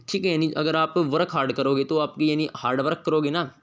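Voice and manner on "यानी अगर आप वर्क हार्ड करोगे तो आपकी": normal talking voice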